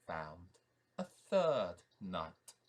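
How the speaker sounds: usual speaking voice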